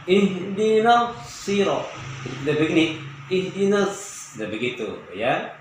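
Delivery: ordinary talk, nothing marked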